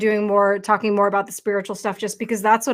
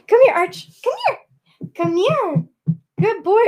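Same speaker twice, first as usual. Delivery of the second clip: high sing-song call to the puppy; calling the dog; 10 semitones higher than usual. Real, not acted. Owner calling her puppy over, high coaxing call.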